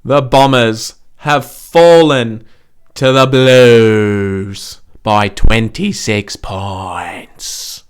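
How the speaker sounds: slow, loud commentator call; mock announcer. Podcaster taunting the Bombers in a mock commentator voice. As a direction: announce the loss like a broadcaster.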